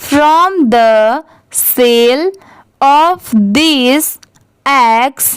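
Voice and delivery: very slow, phrase by phrase, for students to repeat; teacher reading aloud at a crawl, pausing after every few words; slow dictation. Shown as a demonstration. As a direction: read it slowly so the class can echo each chunk. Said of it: "आप भी मेरे बाद repeat करिए".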